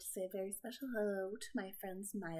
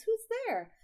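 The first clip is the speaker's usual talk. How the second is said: very high, loud squeaky cry; startled mouse; 14 semitones higher, 11 dB louder than usual. Acted. Mouse spotting eyes in the den, squeaky cry. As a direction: squeak it out in alarm. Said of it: "Mouse cries".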